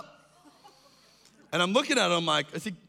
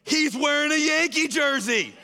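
higher, louder, slow, pointed retort; fan's retort; 8 semitones higher, 4 dB louder than usual. Retold or imitated. Fan pointing out the friend's jersey, high pointed retort. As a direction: point out the obvious with mock disbelief.

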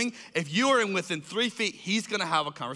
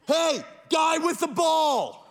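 slow shout; 9 semitones higher, much slower than usual. Retold or imitated. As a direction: bellow it slowly across the section. Tim yells at fan.

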